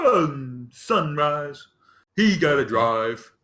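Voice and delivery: dim ogre. Acted. Ogre answers the wake-up question.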